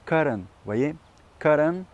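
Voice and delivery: cotton with a spike on co, then flat; heartbeat stress. Shown as a demonstration. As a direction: cotton with a peak like a heartbeat, falling after it. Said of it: "pensez à l'électrocardiogramme".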